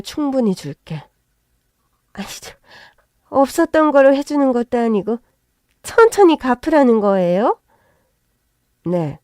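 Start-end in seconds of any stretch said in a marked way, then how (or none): 2.1-2.5 stunned whisper. Acted. Mother-in-law stammers in shock. 3.3-7.6 indignant disbelief. Acted. In-law protests the debt terms.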